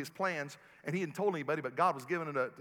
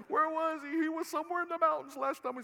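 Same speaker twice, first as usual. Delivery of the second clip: mock high voice; 12 semitones higher than usual. Joking aside about absent pastor.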